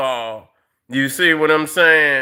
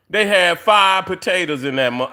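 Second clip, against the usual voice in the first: ordinary talk, nothing marked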